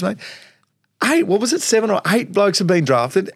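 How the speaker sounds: high, breathless-with-news exclamation; excited mate. Retold or imitated. Teammate on the phone announcing the draft pick, excited. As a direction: imitate a thrilled mate, pitched right up. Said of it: "the excitement in his voice".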